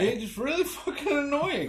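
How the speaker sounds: high mocking voice of the game characters; mock British whine. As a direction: sneer it in a high put-on voice.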